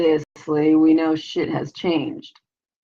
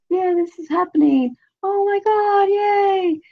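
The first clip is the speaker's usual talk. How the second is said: high-pitched gleeful mock cheering; mock excitement; slower than usual. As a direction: squeal it like thrilled onlookers, mockingly. Acting out an excited crowd.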